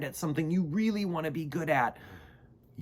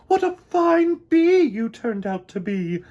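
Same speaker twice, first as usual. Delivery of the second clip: louder, higher praising queen voice; proud queen; 10 semitones higher, 10 dB louder than usual. Acted. Queen bee praising Mister Bumble before the hive. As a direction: praise him like a delighted queen. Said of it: "she said".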